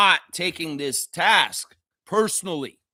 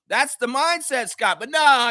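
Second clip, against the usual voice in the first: normal talking voice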